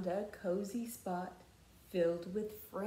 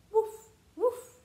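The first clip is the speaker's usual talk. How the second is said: high, bright-sounding yappy puppy woof; puppy bark; 14 semitones higher, 9 dB louder than usual. Acted. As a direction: bark like a little playful puppy. Dog barks in picture book.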